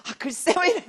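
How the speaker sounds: loud, high cry at dad; retold irritated outburst. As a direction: snap at father in mock exasperation. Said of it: "그러면 막 짜증이 나". (아빠에게 짜증 내는 외침을 재연)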